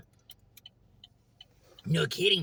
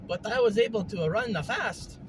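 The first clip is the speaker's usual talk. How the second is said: lilting, Mario-style character voice; slightly raised, Mario-esque voice; 7 semitones higher than usual. Acted. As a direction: talk like a cheerful video game plumber. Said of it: "You sound like Mario".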